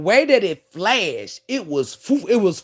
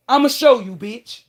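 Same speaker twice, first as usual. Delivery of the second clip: defiant threat; 4 semitones higher, 4 dB louder than usual. Retold or imitated. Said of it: "That's exactly what I said".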